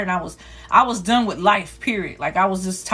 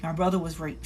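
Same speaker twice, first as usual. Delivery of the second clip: much softer, barely getting it out; quiet confession; 4 semitones lower, 10 dB quieter than usual. Retold or imitated. Girl telling the teacher about her brother, much softer. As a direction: let it drop out almost silently. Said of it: "I said".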